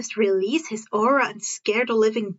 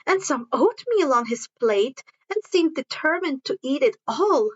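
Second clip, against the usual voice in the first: usual speaking voice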